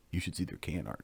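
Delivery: understated nerdy aside, softly dropped; quiet nerdy recommendation; nerd level. Acted. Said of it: "that's nerd level".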